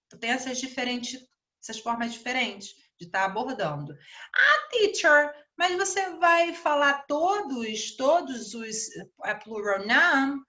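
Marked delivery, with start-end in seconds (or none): 4.3-10.4 student calling out to the teacher, pitched up; questioning student. Acted. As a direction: imitate a pupil piping up with a question.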